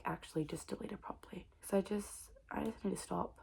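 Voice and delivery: an unvoiced "I just need to stop"; whispered. Real, not acted. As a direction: whisper a private promise. Whispered resolve.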